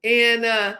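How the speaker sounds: ordinary talk, nothing marked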